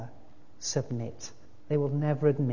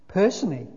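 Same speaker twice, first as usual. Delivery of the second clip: PERSONALLY punched out firmly; emphatic; 5 semitones higher, 5 dB louder, faster than usual. A narrator's reading. Big ghost objecting to the other, emphatic PERSONALLY. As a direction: lean hard on "Personally". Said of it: "with an emphasis which contradicted".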